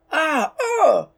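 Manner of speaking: high, sharp cries of being hit; pained cries. A narrator's reading. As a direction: yelp as if struck down. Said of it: "exclaims two of the thieves".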